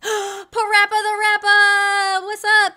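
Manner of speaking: high, loud, drawn-out greeting; gasp, then belt out the name; excited shout-out. Real, not acted. Greets super chat donor excitedly.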